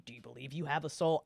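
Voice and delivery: usual speaking voice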